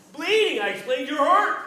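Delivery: high, loud alarmed cry; shocked alarm. A narrator's reading. The narrator sees blood on Terry's leg and cries out in high, loud alarm. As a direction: blurt it out in shock.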